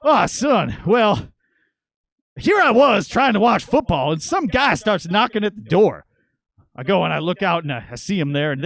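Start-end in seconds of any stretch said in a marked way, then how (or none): 0.0-6.1 slow, high, louder dad voice; dad telling the tale, drawn out; dad telling son about the knock; dad explaining. Retold or imitated. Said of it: "He says".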